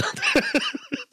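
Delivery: high-pitched giggling fit; real laugh. Real, not acted. Amused by the bat signal joke, laughing high.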